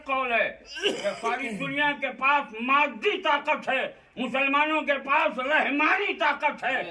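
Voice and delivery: high, insistent pulpit oration; full-force preaching. Acted. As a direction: proclaim it with ringing conviction. Preaches on spiritual power at full force.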